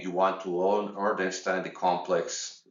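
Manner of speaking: usual speaking voice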